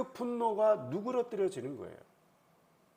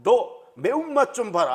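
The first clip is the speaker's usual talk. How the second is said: loud, higher mock thug threat; 깡패의 협박; 4 semitones higher, 9 dB louder than usual. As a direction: play a gangster about to attack, loud and menacing. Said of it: "이게 깡패의 논리 아닙니까". (깡패처럼 큰 소리로 으름장)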